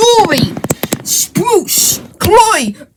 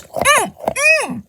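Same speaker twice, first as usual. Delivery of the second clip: high puppet hum; 7 semitones higher, 8 dB quieter than usual. Acted. Puppet answers with hums.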